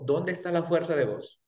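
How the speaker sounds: ordinary talk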